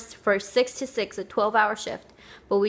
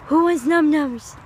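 high, loud sing-song call to the dog; excited pet talk; 6 semitones higher, 5 dB louder than usual. Real, not acted. Owner calling her dogs for a treat, high excited voice. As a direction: tempt the dog with a treat, bright and eager.

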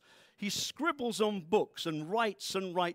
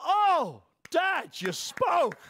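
loud, high gushing; mock gushing; 7 semitones higher, 6 dB louder, much slower than usual. Son amazed his dad spoke, mock excitement. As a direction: gush like an awestruck child.

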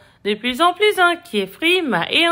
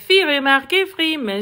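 ordinary delivery

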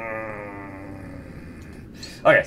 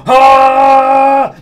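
sudden loud high yell; shout; 10 semitones higher, 14 dB louder than usual. Shown as a demonstration. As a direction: yell into the phone. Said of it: "walking around town going".

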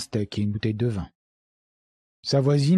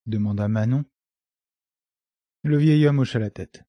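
ordinary delivery, nothing marked

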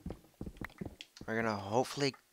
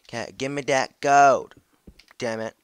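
loud demand; 5 semitones higher, 17 dB louder than usual. Real, not acted. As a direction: bellow for the gold. Grabbing gold, loud demand.

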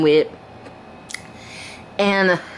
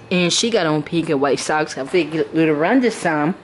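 ordinary talk

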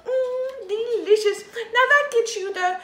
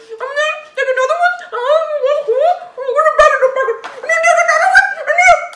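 fast, louder and higher with laughter; racing faster and louder to the end; excited giggling rush; 6 semitones higher, 9 dB louder, much faster than usual. Real, not acted. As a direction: build up to a giddy fast laugh. Giddy confession of cookie stealing.

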